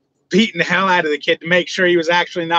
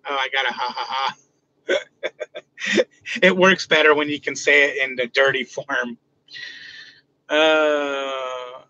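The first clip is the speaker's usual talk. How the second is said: ordinary talk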